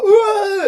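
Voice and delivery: loud, high mock wail; horrified wail. Seeing his cut face in the mirror, loud horrified wail. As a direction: imitate crying out in shock, high and loud.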